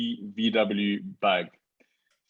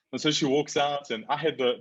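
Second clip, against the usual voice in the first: normal talking voice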